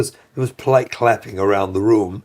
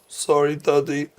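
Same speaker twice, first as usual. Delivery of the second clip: put-on apologetic child; 3 semitones higher than usual. Kid coming back to hug father, imitated childlike apology. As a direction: apologize as a child would, pitched slightly up. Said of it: "your kid comes in".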